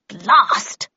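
loud burst of vexation; loud, frustrated exclamation; annoyed curse. Acted. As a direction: curse sharply and loudly at a miss. Miss Havisham curses a missed shot.

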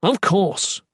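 high, emphatic exclamation; exclaiming. A narrator's reading. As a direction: burst out with the answer. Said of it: "Ethan exclaimed".

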